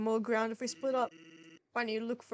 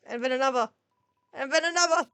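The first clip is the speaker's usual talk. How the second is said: building suspense; 2 semitones higher, 9 dB louder than usual. A narrator's reading. Narrator builds up as the shadow stalks, louder. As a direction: build the tension step by step.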